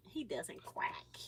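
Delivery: slow, soft whisper; toddler-teasing whisper. Shown as a demonstration. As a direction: breathe it out like a secret.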